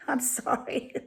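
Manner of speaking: I'm sorry through a real laugh; laughing apology. Real, not acted. Breaks into laughter.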